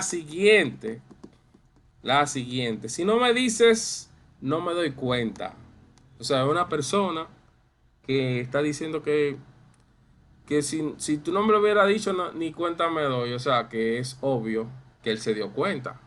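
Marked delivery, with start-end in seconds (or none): 2.9-5.5 high, slow mock-ironic tone; playful ironic quote in a much higher voice. Shown as a demonstration. Teacher voices an ironic speaker. 10.5-13.3 higher, faster playful irony; a hint of teasing irony; mock irony. Shown as a demonstration. Teacher acts the ironic person.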